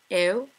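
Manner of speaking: ew said as the Scottish vowel. Shown as a demonstration. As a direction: demo ew the Scottish way. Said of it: "ow becomes".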